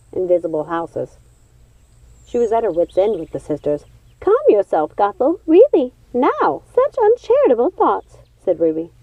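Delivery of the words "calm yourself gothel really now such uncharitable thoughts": high, sing-song scolding witch; tut-tut at a friend's rude thoughts; chiding witch. Acted. Ruby chides Gothel's thoughts.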